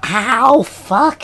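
sudden real pain yelp; cat clawing thighs. Real, not acted. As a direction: yelp as claws dig in. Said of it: "clawing the fuck out of my thighs".

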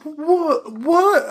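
very high, disbelieving yelp; shocked boy. Acted. Boy hears that the protection is gone, shocked. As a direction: squeak it in shock. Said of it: "he's totally shocked".

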